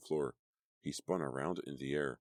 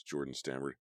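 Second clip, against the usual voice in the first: usual speaking voice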